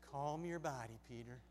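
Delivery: low, soft, dry reply; calming Jesus. Acted. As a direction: bring it right down to settle him. Jesus settles an overexcited Peter.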